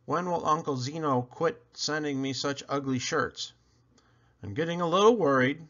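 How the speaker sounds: usual speaking voice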